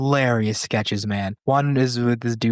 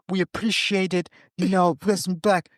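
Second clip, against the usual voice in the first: higher-pitched London street talk, lightly imitated; British gang accent; 8 semitones higher than usual. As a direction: play a rival gang member. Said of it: "two rival British gangs".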